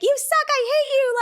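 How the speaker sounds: high, loud mocking shriek; mock sister scream. Sisters fighting, one screams at the other, high mock shriek. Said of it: "screaming, like".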